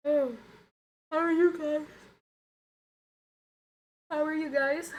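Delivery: high, loud yawned greeting; talking through yawns. Real, not acted. Streamer greeting viewers while unable to stop yawning, yawning speech. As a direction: greet people in the middle of a big yawn. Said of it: "I can't stop yawning".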